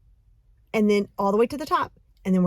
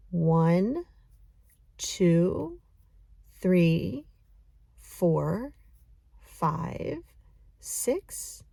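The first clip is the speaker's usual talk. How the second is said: slow breath count; 4 semitones lower, much slower than usual. Shown as a demonstration. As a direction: count slowly, each number paced with one breath. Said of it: "have a cadence of how I want you to count".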